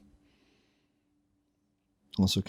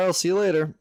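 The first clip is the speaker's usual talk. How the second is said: high, bright farewell; light, breezy goodbye; cheerful character voice; 9 semitones higher, faster than usual. Retold or imitated.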